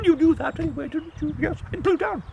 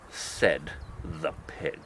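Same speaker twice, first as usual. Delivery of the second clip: usual speaking voice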